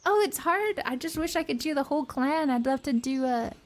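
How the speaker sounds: usual speaking voice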